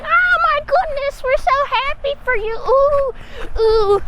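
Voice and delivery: very high cooing delight; high swooning congratulations; gushing onlookers. Retold or imitated. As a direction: coo like overjoyed friends. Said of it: "everyone's like".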